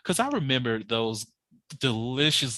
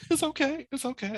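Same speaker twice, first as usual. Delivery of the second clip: mock brave sniffle; 13 semitones higher, 4 dB quieter than usual. Mock self-consolation.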